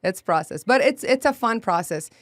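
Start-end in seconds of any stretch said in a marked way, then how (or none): none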